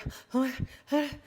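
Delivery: exaggerated high, breathless panting; mock gasps, as if badly winded; out-of-breath gasping. Shown as a demonstration. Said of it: "like you're out of breath".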